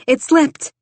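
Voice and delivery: high, loud mock-innocent excuse; woman pretends the sponge slipped; fake innocence. A narrator's reading. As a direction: sing it out like it was an accident. Jane feigns an accident.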